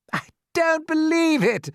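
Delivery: high, loud disbelief; shouting. A narrator's reading. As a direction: holler it in scornful disbelief. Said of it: "shouted the centipede".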